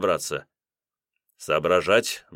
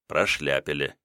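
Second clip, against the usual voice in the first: usual speaking voice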